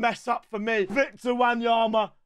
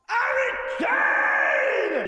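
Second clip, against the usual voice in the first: drawn-out cheer; 11 semitones higher, much slower than usual. Real, not acted. Streamer celebrating the cameo's pick, drawn-out cheer. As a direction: stretch it out like a goal call.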